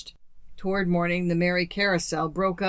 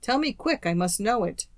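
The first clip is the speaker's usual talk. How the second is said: plain reading voice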